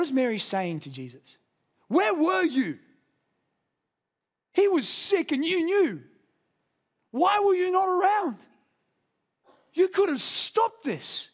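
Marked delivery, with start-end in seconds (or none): 1.9-11.3 high, anguished accusing cry; raw grief. Acted. Mary to Jesus, high anguished reproach. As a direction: pour out the hurt at a friend who came too late. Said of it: "This kind of raw emotion".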